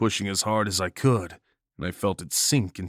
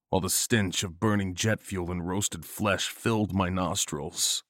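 ordinary delivery

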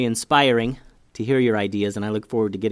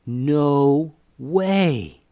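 amazed; 4 semitones higher, 4 dB louder than usual. A narrator's reading.

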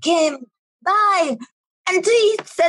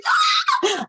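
mock scream. Parents screaming, imitated.

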